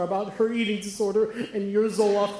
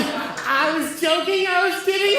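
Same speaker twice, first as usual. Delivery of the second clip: panicked backpedal; 10 semitones higher, 4 dB louder, slower than usual. Retold or imitated.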